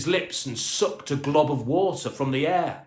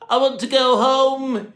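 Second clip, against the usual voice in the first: high, louder tearful plea; sobbing; 11 semitones higher, 7 dB louder than usual. A narrator's reading. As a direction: cry it out like a scared boy. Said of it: "he sobbed".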